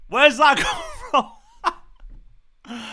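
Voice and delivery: loud, high disbelief breaking into laughter; amazed shout. Real, not acted. Fan amazed by the goal, disbelieving laugh. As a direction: cry out in wonder.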